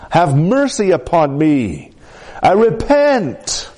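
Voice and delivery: slow, rising to a high plea on repent; pleading repentance. Retold or imitated. David begging God for mercy, slow high pleading. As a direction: cry out for mercy, drawn out.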